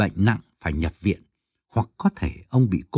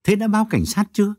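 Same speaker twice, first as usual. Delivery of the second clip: quick, higher interrupting question; fast urgent interruption; cutting in; 9 semitones higher, faster than usual. A narrator's reading. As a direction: jump in before she finishes. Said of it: "Tấn ngắt lời".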